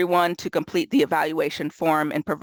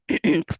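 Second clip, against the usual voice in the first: quick real throat clear. Real, not acted. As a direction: clear your throat briefly. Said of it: "throat clearing".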